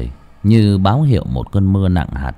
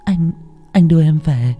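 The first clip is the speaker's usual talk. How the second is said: lightly voiced frail girl, higher; timid, fragile girl's voice; weak young woman; 6 semitones higher than usual. A narrator's reading. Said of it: "yếu ớt".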